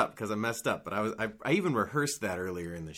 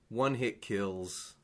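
slow emphatic; much slower than usual. Acted.

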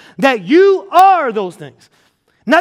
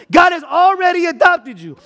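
rising preaching shout; 5 semitones higher than usual. Real, not acted. Preacher proclaiming adoption to the crowd, building to a shout.

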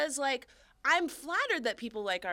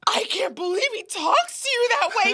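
higher, louder scandalised disbelief; mock shocked coworker; 11 semitones higher, 9 dB louder than usual. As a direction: play the horrified coworker. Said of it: "And she was like".

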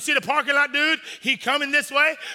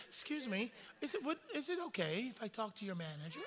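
much softer, prim and polite; mock polite request; 17 dB quieter than usual. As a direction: ask sweetly for the manager. Polite restaurant complaint voiced.

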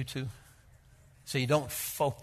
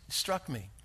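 usual speaking voice

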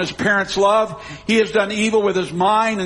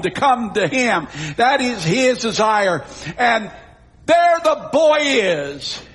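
high, ringing sermon voice with drawn-out key words; full-force preaching; 4 semitones higher, slower than usual. Acted. Preacher tells congregation God wants all to come home, full-force preaching. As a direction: stretch the big words out for the congregation.

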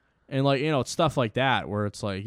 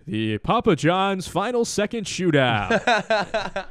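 slow, higher arena PA announcer; Phil Nevin impression; 8 semitones higher, 5 dB louder, much slower than usual. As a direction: announce it like the stadium PA guy. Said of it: "I always do, like, Phil Nevin".

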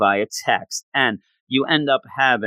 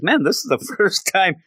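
higher-pitched, giddy retold thought; excited; 6 semitones higher than usual. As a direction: act out his amazed excitement. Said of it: "then he gets so excited".

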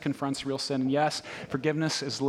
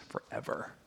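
whispered; 13 dB quieter than usual. Acted. Punchline on endless debt.

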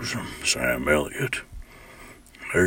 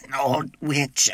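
witch voice; 8 semitones higher, 4 dB louder, slower than usual. Shown as a demonstration. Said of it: "like an old witch type".

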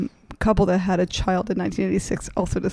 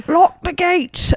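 high, slow, far-off put-on British; hammed-up British accent; mock British accent; 9 semitones higher, 4 dB louder, much slower than usual. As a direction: drag it out like a stagey Brit. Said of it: "that was British".

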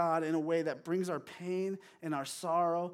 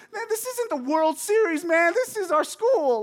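very high, loud hammed-up yell; shrieky mock outburst at a classmate; losing it; 11 semitones higher, 10 dB louder than usual. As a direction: act out an emotional kid losing it. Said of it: "just losing it".

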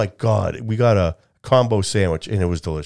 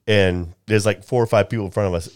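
usual speaking voice

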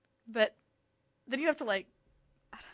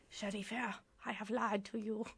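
put-on soft-spoken, clumsy German accent; bad German accent; 10 dB quieter than usual. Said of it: "little German accent. That was a really bad German accent".

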